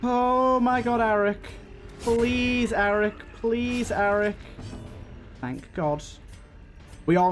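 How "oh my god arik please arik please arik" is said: usual speaking voice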